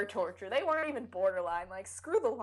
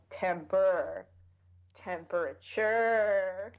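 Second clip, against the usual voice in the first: "temperature" stretched slowly on its last syllable; drawn-out word; much slower than usual. Shown as a demonstration. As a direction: draw out temperrr, then temperaturrre. Draws the word out for effect.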